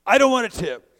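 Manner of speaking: sudden loud, high angry shout; retold shout at the passenger; furious driver. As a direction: bark it back like a furious cabbie.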